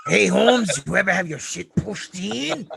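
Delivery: a lightly voiced Los Angeles street tough; Training Day gangster impression. Guest answering the host's impression with his own, lightly voiced thug. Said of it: "You sound like the fucking dude from Training Day".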